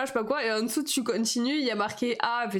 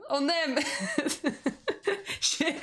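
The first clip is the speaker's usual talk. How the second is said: words broken by a real laugh; laughing at her own taste in kitsch decorations; 4 semitones higher, slower than usual. Real, not acted. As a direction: giggle through it.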